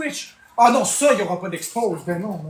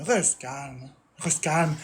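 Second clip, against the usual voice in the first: lower, much softer mock soothing; hushed parody of calming advice; 7 semitones lower, 8 dB quieter, much slower than usual. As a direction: murmur it low like a fake therapist. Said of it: "mocking chat's advice".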